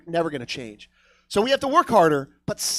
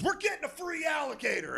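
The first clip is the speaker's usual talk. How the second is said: high-pitched, softened mock holler; strained, giddy drunken announcement; drunk excited friend; 9 semitones higher, 9 dB quieter than usual. Drunk buddy at the door at noon, high excited mock holler. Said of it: "shows up very drunk".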